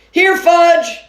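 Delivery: high, loud call; boy calling his brother down the aisle. A narrator's reading. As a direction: call him like a lost dog. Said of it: "I called".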